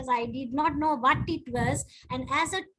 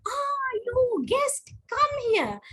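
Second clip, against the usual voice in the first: slow, high mock summons; hammed-up table topic master; summoning master; 10 semitones higher, slower than usual. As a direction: call the guest up like a ringmaster. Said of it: "he's like".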